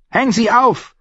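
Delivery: high, curt landlady order; bossy innkeeper. Acted. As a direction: order your husband about.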